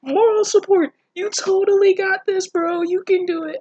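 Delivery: high, loud, hammed-up cheering; mock cheerleader. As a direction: cheer on a dying bro like a useless cheerleader. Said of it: "she's just standing back there like".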